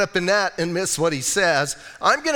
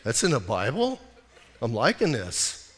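stoner townsfolk; 7 semitones lower, 5 dB quieter than usual. Acted. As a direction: drop way down like a pleased stoner. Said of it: "'Cause Bisbee people go".

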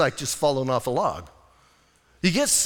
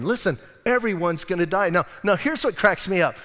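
ordinary talk, nothing marked

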